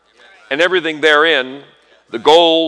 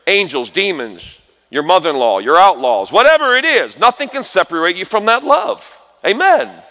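rising, louder preacher's cry building to a peak; full-force preaching; 6 semitones higher, 5 dB louder than usual. Acted. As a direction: pastor preaching to his congregation, building to a loud high cry.

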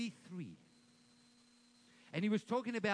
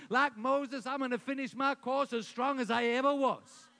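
ringing, louder confession of faith; loud declaration; 5 semitones higher, 6 dB louder than usual. Shown as a demonstration. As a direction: proclaim each item like a promise. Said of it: "you need to declare over your life".

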